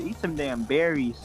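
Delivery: normal talking voice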